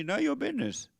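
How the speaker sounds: None of your business jumps high in pitch; high, curt old-school brush-off; sharp retort. Retold or imitated. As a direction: fling it back up high and curt. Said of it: "people tell you".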